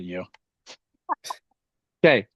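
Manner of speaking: ordinary delivery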